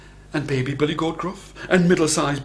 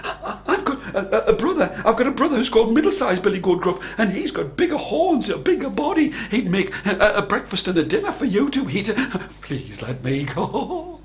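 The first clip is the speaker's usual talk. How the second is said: high, stammering little goat; frightened baby goat; 7 semitones higher, 3 dB louder than usual. A narrator's reading. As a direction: gabble a desperate excuse in a high voice. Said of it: "baby Billy Goldgruff thought to itself".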